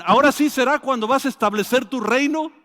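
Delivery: loud, high, impatient question; eager disciples. Retold or imitated. Disciples pressing Jesus about the kingdom, loud and high. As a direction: blurt it out full of hope.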